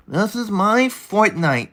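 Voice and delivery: high, slow nasal-free nerdy put-on voice; put-on nerd voice. Streamer trying out a nerdy voice for his chat, high and slow. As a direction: pitch way up and drawl it like a know-it-all. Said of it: "it's too nerdy".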